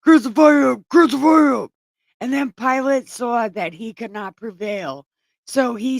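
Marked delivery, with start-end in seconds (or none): 0.0-0.9 loud mob chant, pitched up; hammed-up mob yell; crowd crying out. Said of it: "they still cried out". 0.9-1.7 loud and higher, chanting back; hammed-up repeated mob yell; crowd crying out again. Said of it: "they still cried out".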